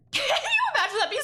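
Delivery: high, loud laughing shout. Real, not acted. Vlogger cracking up at her own joke, laughing shout. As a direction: shriek it through a laugh.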